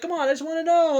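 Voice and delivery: high, loud imitated plea; exaggerated high-pitched begging; mock pleading. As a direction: whine it up high, hammed-up. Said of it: "It's like".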